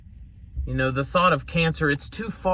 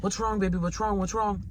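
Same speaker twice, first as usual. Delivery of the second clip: soft, higher soothing dad voice; concerned father; 6 semitones higher, 4 dB quieter than usual. Retold or imitated. Father comforting his crying daughter, soft and worried. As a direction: gently ask what's wrong, pitched up and hushed. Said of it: "my daughter came in crying, hysterical".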